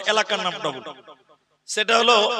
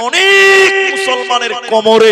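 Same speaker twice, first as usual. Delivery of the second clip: loud, slow, higher call to the crowd; fiery sermon shout; preacher shout; 7 semitones higher, 8 dB louder, slower than usual. Acted. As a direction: shout it out over the gathering.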